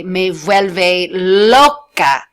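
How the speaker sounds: "Me vuelve loca el español" very slowly; slow model sentence. Shown as a demonstration. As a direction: drag out each word of "Me vuelve loca" for learners. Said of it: "I would say".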